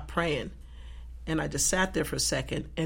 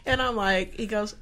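ordinary delivery

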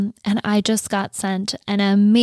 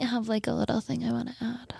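softly whispered aside; playful whisper; 2 semitones higher, 11 dB quieter, faster than usual. Real, not acted. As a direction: whisper it like a little secret. Teasing aside about adding something.

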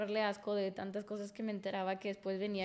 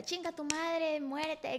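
sharp, higher mock fury; snapping back at him to scare him off; retold angry outburst; 6 semitones higher, slower than usual. Telling her boyfriend off, in a raised retold snap.

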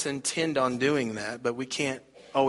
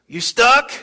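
loud, high "You stuck?"; Speedo man calling out; 9 semitones higher, 9 dB louder than usual. Retold or imitated. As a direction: call it out like a beach stranger.

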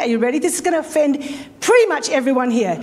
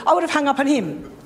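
ordinary delivery

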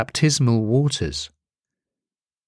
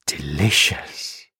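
ordinary delivery, nothing marked

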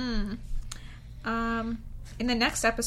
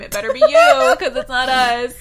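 loud laughing joke; 14 dB louder than usual. Pretend. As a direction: shout the joke out, grinning. Loud joking jab at listeners.

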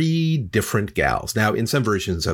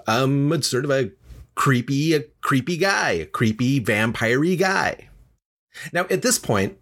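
ordinary delivery

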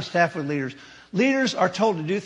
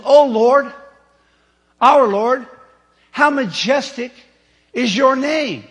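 reverent psalm reading; 4 semitones higher, 4 dB louder, slower than usual. Acted. Reading psalm aloud, exalted.

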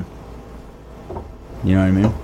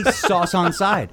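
higher, slowed mock Chinese-English phrasing; mock Chinese accent; 8 semitones higher, much slower than usual. Confessing how he orders food, unthinking mock Chinese accent. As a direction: slip into a caricatured Chinese accent. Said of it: "That just comes out that way".

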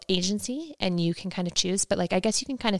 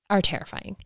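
ordinary talk, nothing marked

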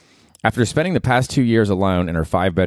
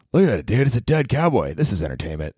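mock awed fan; 4 semitones higher than usual.

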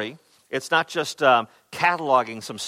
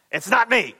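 higher, emphatic "It's NOT me"; emphatic denial; 9 semitones higher, 4 dB louder than usual. Retold or imitated. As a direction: deny it with full emphasis, pitched up. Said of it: "he is so emphatically saying".

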